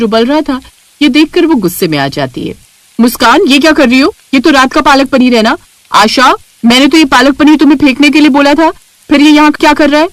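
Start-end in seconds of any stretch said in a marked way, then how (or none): 3.0-10.1 higher, louder, scolding; angry sister-in-law. Acted. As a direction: catch her in the act and scold. Elder sister-in-law finds the dish. (गुस्से में जेठानी, ऊँची डाँट)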